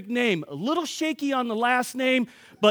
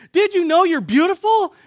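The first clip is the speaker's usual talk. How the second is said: high, loud call to a passer-by; raised, exuberant voice; excited retold yelling; 7 semitones higher, 5 dB louder than usual. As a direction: call it out joyfully to everyone you see. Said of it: "I'm yelling".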